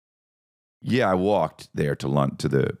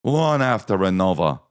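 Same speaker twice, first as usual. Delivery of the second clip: put-on British accent. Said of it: "to hear their accents".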